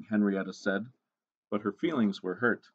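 ordinary delivery

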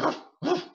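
high playful mock barking; dog bark. Shown as a demonstration. Teacher showing animal sounds, playful high bark. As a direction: yip like a little dog. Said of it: "the animal sounds like".

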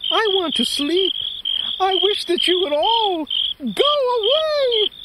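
high mouse voice. Acted. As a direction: beg the crickets to leave, squeaky. Mouse voice held.

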